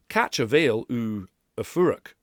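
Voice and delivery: "Catch a veil u a furach" in slow flat English sounds; anglicised "Catch a veil u a furach"; English phonology. Shown as a demonstration. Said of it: "listen to the difference between".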